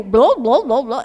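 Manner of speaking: blô blô mais alto, entre risos, como alto-falante com eco; imitando máquina com eco. Retold or imitated. (imitando a máquina do pedágio, rindo)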